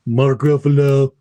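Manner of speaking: a hint of Mark Ruffalo's voice; Mark Ruffalo impression. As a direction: lightly mimic Mark Ruffalo. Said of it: "let me do that".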